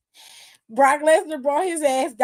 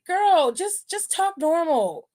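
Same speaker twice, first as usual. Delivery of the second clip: put-on higher, sing-song girl-just-talk-normal; sassy mock reassurance, higher; high sassy scolding; 6 semitones higher than usual. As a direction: talk down to her like a child. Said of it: "It's like".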